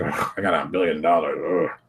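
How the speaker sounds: mock arrogant sneer. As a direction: play the arrogant rich guy. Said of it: "arrogant ass kind of move".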